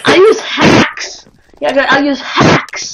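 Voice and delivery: put-on slow, Russian-accented villain boast; Soviet accent. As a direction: gloat in a heavy Soviet accent. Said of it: "the word hacks".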